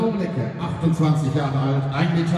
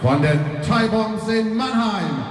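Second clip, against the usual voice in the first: boxing ring announcer; 9 semitones higher than usual. Acted. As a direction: stretch the names like a ring announcer. Ring introduction of fighters. (Ringsprecher, der die Namen langzieht)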